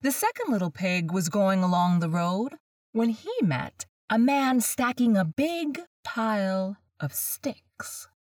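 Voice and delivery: plain reading voice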